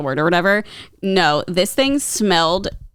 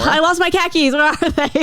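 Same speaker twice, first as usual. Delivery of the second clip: high, broad Boston accent; 8 semitones higher than usual. Shown as a demonstration. Host demos the Boston khakis line in a Boston accent. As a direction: whine it like a Bostonian hunting keys. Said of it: "Sounds like I lost my car keys".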